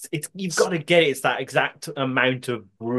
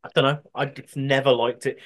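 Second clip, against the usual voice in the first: ordinary delivery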